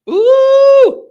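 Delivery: loud, high-pitched "ooh"; thrilled high hoot; excited whoop. Real, not acted. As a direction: whoop it out at full volume. Host whoops after caller's fiery rant.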